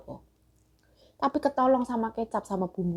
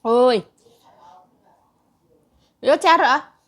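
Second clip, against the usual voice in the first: sudden loud yelps from the heat; loud shout; 4 semitones higher, 14 dB louder than usual. Real, not acted. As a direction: shout it out, burning mouth.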